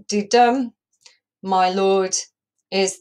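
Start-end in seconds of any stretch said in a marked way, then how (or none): 0.0-0.7 didum with the beat on dum; iambic beat. Shown as a demonstration. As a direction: sound out da-DUM. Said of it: "counting in that iambic beat".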